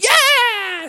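high drawn-out cheer; group cheer. Acted. The whole family heading into the park, high cheer. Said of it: "And everybody said".

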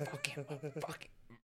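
hushed, excited imitation; mock secret egging-on. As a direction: urge him on under your breath. Friend secretly egging him on.

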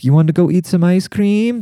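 parent asking. Retold or imitated. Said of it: "anything they would ask the kid".

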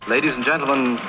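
normal talking voice

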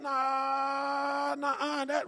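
long, held "nah" of disbelief; stalling, stammered protest; drawn-out objection. Acted. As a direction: balk with a stretched-out no. Acts Charles objecting to news.

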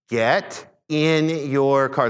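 very slow, word-by-word order; slowed right down. Retold or imitated. Dad repeating himself to a confused stranger, extra slow. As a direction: spell it out slowly so he understands. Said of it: "I said it slower just so he could understand it".

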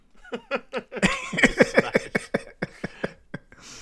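loud, high wheezing laugh; big laugh. Real, not acted. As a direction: collapse into breathless laughter. Laughing at okie dokie pun.

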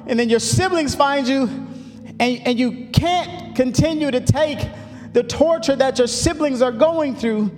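rising, building sermon cadence with long held words; fiery preaching. Acted. Pastor preaching to his church, climbing, full-force sermon delivery. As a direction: preach it out to the congregation, pitch climbing.

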